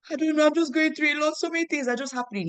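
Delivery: high, strained tearful voice; half-acted sobbing complaint; retold crying. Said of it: "The way I was crying".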